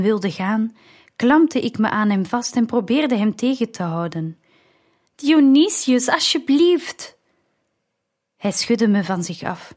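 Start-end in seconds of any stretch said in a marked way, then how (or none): 5.1-7.2 high, drawn-out plea; pleading. A narrator's reading. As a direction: beg him to stay, voice pitched up. Clinging to departing brother, pleading. (smekend, hoog en langgerekt)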